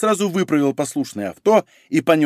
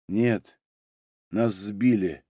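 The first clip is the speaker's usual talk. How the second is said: low, soft and drained; colourless voice; 6 semitones lower, 6 dB quieter, much slower than usual. A narrator's reading. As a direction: state it without any colour, quietly. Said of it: "бесцветным голосом сказал Малинин". (тихо, бесцветным голосом)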